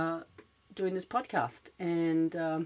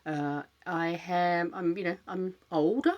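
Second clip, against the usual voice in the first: ordinary delivery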